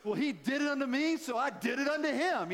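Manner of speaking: a hint of a cheeky child justifying; child answering his mom, mildly mock-innocent; kid excusing himself. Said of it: "I said".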